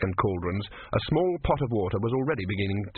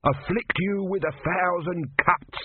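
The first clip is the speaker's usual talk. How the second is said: high, slow, strange voice; cursing tortoise god; 8 semitones higher, slower than usual. Acted. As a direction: hurl the curse up from the basket.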